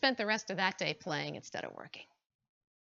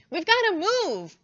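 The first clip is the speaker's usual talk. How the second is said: squirrel voice; 9 semitones higher, 9 dB louder than usual. Acted. Squirrel council, urgent plea.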